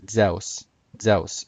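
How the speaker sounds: ZEUS with an eh-oo glide, zeh-oos; classical Greek pronunciation. Shown as a demonstration. Presenter attempting a hard word, classical Greek pronunciation. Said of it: "kinda hard for me to pronounce it".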